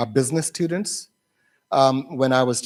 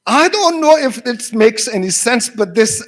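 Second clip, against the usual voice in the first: high, loud acted student reply; imitated flustered student; 8 semitones higher, 6 dB louder than usual. Russian student reading out to teacher, flustered retelling. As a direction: act out a student's embarrassed preamble. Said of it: "and she was laughing".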